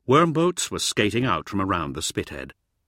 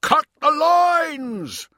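drawn-out high shout at top volume; full-voiced yell; 8 dB louder, much slower than usual. A narrator's reading. Yelling an urgent order to the boat's crew. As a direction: bellow it slowly, stretching the words. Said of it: "Fifth yelled".

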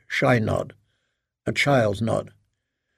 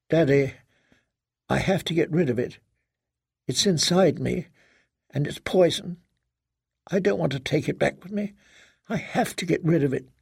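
hushed, higher pleading voice; distressed daughter; 3 semitones higher than usual. Acted. Daughter confiding to father in bed, hushed and distressed. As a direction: confide it in small, pained phrases.

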